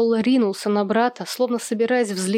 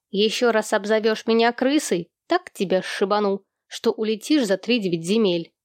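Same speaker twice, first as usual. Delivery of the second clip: usual speaking voice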